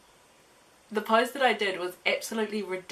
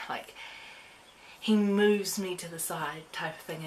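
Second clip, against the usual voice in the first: ordinary delivery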